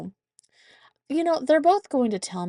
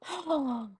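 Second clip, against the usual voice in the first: excited gasp; 8 semitones higher, 4 dB quieter than usual. Retold or imitated. Doorbell rings, girl gasps.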